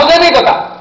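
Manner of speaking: very high, loud mock retort; acted irritated retort. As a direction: fling it back at a high pitch. Mahatma retorts in the acted quarrel.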